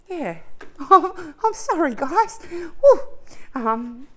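high, loud emotional laugh while apologising; laughing through tears. Real, not acted. Laughing through tears about parents.

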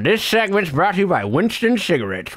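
upbeat retro cigarette commercial; chipper 1950s ad. Pretend. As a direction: sell it like an old-time radio announcer. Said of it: "Brian, hold on".

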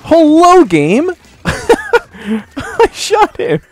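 excited squeal. Real, not acted. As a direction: squeal it out at a missed shot. Frustrated at a missed shot.

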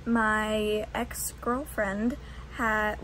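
ordinary talk, nothing marked

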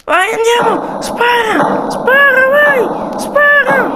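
very high, loud battle orders; shouted fire command. Pretend. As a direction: yell at them to shoot.

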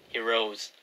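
ordinary delivery, nothing marked

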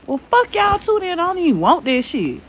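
hammed-up wounded pride; mock offended. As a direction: fake sulk at being left out. Pretend offense at being ignored.

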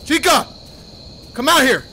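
loud, high summoning shout; calling out. Real, not acted. Player calling Chica out of hiding, shouting. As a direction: holler for someone hiding.

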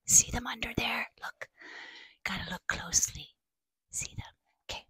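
soft, near-whispered aside; hushed aside. Acted. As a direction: drop to a hush for the little listeners.

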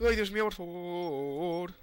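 slow wail after a scare; drawn-out pleading. Real, not acted. Player begging Javi to stop, drawn-out pleading. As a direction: stretch the plea out long.

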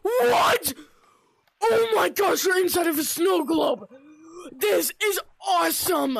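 loud, amazed outburst; overjoyed yelling; screaming with excitement. Real, not acted. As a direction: shout it in real, thrilled disbelief. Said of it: "I'm gonna stop screaming".

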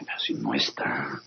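hushed, unvoiced surprise; a soft whisper of disbelief; whispered aside. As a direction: whisper it to yourself. Finds the jail empty.

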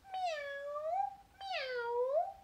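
high, rising meows; cat meow. Acted. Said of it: "said the cat".

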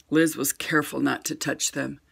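ordinary delivery

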